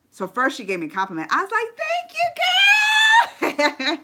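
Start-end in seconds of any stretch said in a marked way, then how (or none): 1.8-4.0 gushing thanks. Retold or imitated. Flattered fan squealing thanks to a star. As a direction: squeal thanks, thrilled by the compliment.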